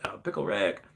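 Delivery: low, muttered catchphrase impression; Rick from Rick and Morty. Streamer imitating fans quoting a favorite show, soft Rick impression. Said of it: "Some of them are just like".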